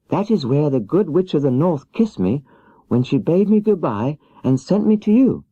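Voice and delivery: put-on little girl pleading for help; young Dorothy. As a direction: play Dorothy small and meek, pitched high. Dorothy answers Oz.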